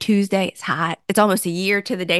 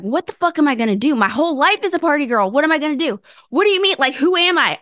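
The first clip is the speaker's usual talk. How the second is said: rising, faster panicky self-quote; panicked questions; 6 semitones higher, faster than usual. Retold or imitated. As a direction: spill out the questions in a retold panic. Panicked self-quote about lost life.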